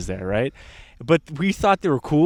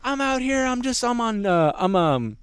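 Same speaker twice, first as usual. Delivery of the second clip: put-on high-pitched drunken protest; hammed-up explaining to officers, pitched up; indignant drunk friend; 8 semitones higher than usual. Imitating his friend explaining himself to police, indignant drunk voice.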